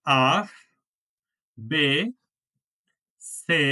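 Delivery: আ, বে, সে as ah, beh, tseh with a pause after each; one letter at a time: আ, বে, সে; German letter names. Shown as a demonstration. Teacher models the German alphabet for the class: আ, বে, সে. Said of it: "প্রথমটা উচ্চারণ হলো".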